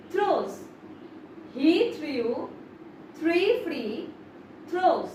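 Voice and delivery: said in two-word chunks with pauses; chunked practice of "He threw three free throws"; divided into chunks. Shown as a demonstration. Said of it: "এটাকে একটু divide করে দেখি".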